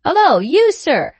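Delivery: loud, high hail; another shout. A narrator's reading. Sam calling the stranger a third time, shout. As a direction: shout to get his attention. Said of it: "brought to again by another shout".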